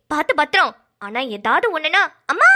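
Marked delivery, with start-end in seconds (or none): 2.3-2.5 sharply rising mock cry for help; demonstrated call for mother. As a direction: act out a daughter calling for her mother. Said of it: "அப்படின்னு ஒரு குரல் மட்டும் கூட". (அம்மாவைக் கூப்பிடும் குரலை நடித்துக் காட்டுதல்)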